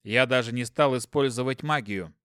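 ordinary delivery, nothing marked

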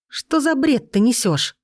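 high, slow, blurted disbelief. A narrator's reading. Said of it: "выпалила я".

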